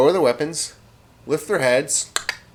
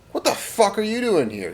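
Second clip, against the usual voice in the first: high-pitched British disbelief; British accent; 9 semitones higher than usual. Acted. British soldier confronting a charging enemy, high British accent. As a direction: challenge him in a raised British voice. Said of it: "he says in a British accent".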